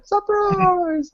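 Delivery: very high squeaky monster voice; sillier monster voice. Retold or imitated. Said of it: "with increasingly silly monster voices like".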